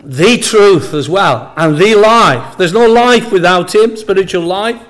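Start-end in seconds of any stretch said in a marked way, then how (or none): 0.0-2.6 each "the" said slowly as a full "thee"; stress on THE. Shown as a demonstration. Preacher underlining Jesus's exact words, stressed THE. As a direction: draw out "the" as "thee" before truth and life. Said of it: "He didn't say, "I am a way".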